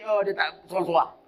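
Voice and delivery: usual speaking voice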